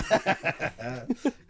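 loud high laugh. Real, not acted. Laughing at the Luke joke, high loud laugh. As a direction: bursts out laughing.

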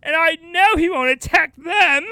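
high, loud confident declamation; storybook actor. Acted. Actor presenting companions to the tiger, hammy confidence. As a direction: announce it with misplaced grandeur. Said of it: "maybe he'll be like".

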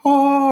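high, held angelic choir note; mock-celestial sustained high "oh"; heavenly "oh". Storyteller mimicking heaven opening as his crush floats out, angelic.

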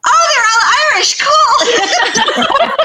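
high, loud, hammed-up mock shock, laughing through it; mock dismayed outburst. Narrator realizing the whole book is Irish, hammed-up dismayed cry. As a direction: throw it up high like a comic groan at the news. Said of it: "And then I'm like".

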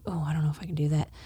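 whispered self-doubt, fast and unsure; doubtful whisper. Pretend. Believer whispering doubts about inviting a friend, whispered. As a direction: whisper it like a nagging doubt in your head. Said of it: "this little voice in your head".

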